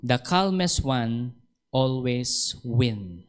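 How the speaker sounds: "calmest" stretched out, very slow; the Lord's message spelled out slowly; slow emphatic quote. Shown as a demonstration. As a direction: lay the words down slowly. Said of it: "sabi ng Panginoon sa akin".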